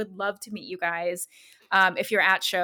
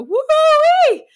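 loud, much higher whoop; surprised whoop; 13 dB louder than usual. Retold or imitated. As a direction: yelp it out as a car cuts in. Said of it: "and I just go".

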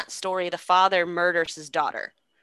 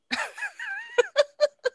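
high squealing burst of laughter. Real, not acted. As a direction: crack up helplessly at your own punchline. Teller cracks up at punchline.

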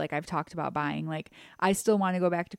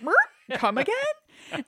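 high, slow incredulous question; stunned disbelief; 13 semitones higher, slower than usual. Retold or imitated. Eighteen-year-old hearing dad was Santa, high slow disbelief. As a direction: ask as if the record just skipped. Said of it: "I was like".

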